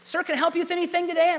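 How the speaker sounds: put-on high, quick, chirpy shop-girl greeting; young saleswoman. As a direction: play the eager young clerk. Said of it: "young lady, employee".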